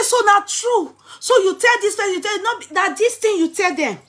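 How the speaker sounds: high, loud mock indignation; shocked retort. Husband retorts to wife about telling others, high and indignant. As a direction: play the husband aghast at being exposed.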